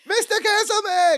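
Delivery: high-pitched frantic character call; mock calling out. Imitating Balki banging on the door.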